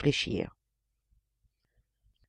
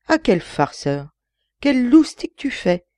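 laughing; 8 semitones higher, 5 dB louder, slower than usual. A narrator's reading. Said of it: "fit-il en s'esclaffant".

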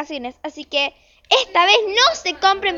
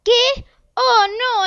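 alarmed surprise; 6 semitones higher, much slower than usual. Acted. Mother seeing snow at the airport, higher and alarmed. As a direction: react to the bad news in shock.

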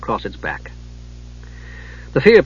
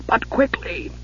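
higher, softer hurried urging; hushed urging; 9 semitones higher, 7 dB quieter, slower than usual. A narrator's reading. Dwarf urging Palin onward, higher and softer. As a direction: press them on under the breath. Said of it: "he cautioned".

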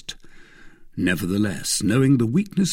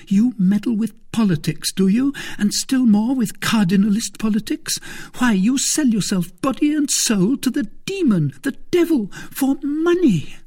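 high, louder, climbing tirade; outraged wife; 14 semitones higher, 5 dB louder than usual. Acted. Wife accusing husband of selling his soul, outraged. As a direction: berate him, building to horror. Wife presses her furious attack.

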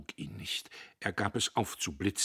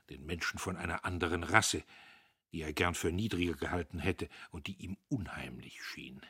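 ordinary delivery

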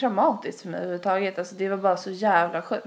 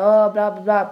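louder, dismissive blah blah; mock blah blah; 10 dB louder than usual. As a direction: brush it off with a loud blah. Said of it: "du vet, bara".

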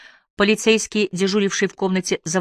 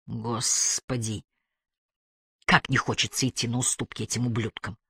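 low, soft grudging undertone; barely audible, through teeth; 5 semitones lower, 6 dB quieter than usual. A narrator's reading. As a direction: say it under your breath through gritted teeth. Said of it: "Сквозь зубы чуть слышно произнесла она".

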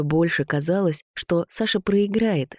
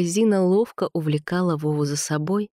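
plain reading voice

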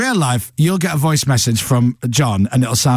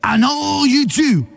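John Bishop stage voice; 8 semitones higher, slower than usual. Retold or imitated. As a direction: belt it out slowly like a Scouse comic on stage. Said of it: "if you've ever seen John Bishop on stage".

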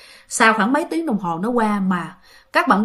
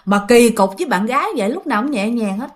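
ordinary talk

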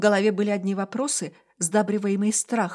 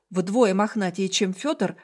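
plain reading voice

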